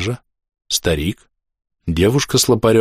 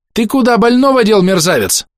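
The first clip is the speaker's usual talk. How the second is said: high, furious yell; гневный крик; 13 semitones higher, 5 dB louder than usual. A narrator's reading. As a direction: tear into the scoundrel. Doctor furiously confronts the orderly. (яростный крик высоким голосом)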